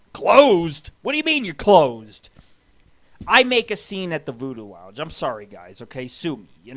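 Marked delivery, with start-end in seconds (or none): 0.1-2.3 louder, affronted questioning; indignant disbelief. Retold or imitated. Customer told the lounge is closed, indignant disbelief. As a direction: throw it back at them in outrage.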